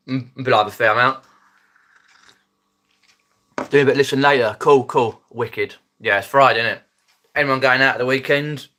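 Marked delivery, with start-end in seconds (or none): none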